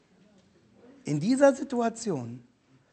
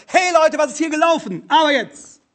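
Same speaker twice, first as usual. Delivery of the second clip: higher, louder barked demand; mock angry shout; 9 semitones higher, 7 dB louder than usual. Preacher imagines God barging in, mock shouting. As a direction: storm in like a furious boss.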